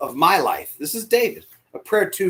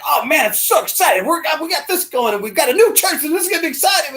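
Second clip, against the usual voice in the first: excited friend; 9 semitones higher, 5 dB louder than usual. Retold or imitated. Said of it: "He's like".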